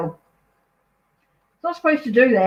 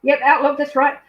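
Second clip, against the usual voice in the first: ordinary talk, nothing marked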